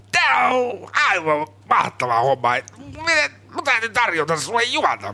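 imitating Jukka Virtanen. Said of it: "Se kuulosti enemmän siltä, että sä koko ajan painostat".

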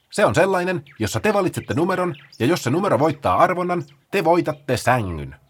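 high and quick, slowing at the end; eager Paula. Acted. Paula rattles off the rules to the sloths, high and fast. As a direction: explain it like an excited organiser.

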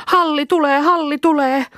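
joyful rescue call; excited child shout. Acted. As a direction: cry out as help arrives. Child sees dog coming.

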